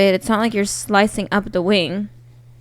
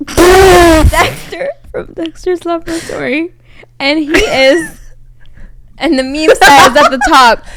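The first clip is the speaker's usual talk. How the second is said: laughing; 6 semitones higher, 8 dB louder than usual. Real, not acted. Laughing while telling story.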